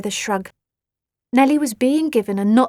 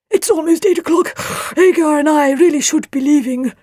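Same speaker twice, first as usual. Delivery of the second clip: loud, raised-pitch story father; Leafmould's hurried character voice; 6 semitones higher, 6 dB louder than usual. A narrator's reading. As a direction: announce it loudly in a put-on character voice.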